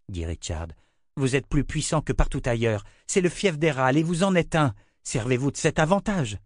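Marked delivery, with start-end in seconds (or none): 1.2-6.4 higher, pushing hard; pressing, higher insistence; insistent. Acted. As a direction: urge him to use his power. Richard presses the prophet.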